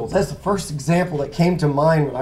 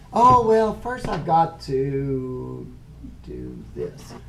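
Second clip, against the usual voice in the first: stalling excuse; much slower than usual. Retold or imitated. Acting out a follower's excuse.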